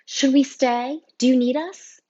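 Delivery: concerned student. A narrator's reading. Student asking a teacher if she is needed, concerned.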